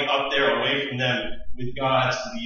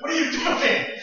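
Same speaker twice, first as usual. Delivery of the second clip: much higher, sharp angry cry; angry outburst. Acted. Moses confronting the idol worshippers in anger. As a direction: Moses furious at the people. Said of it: "He gets all angry".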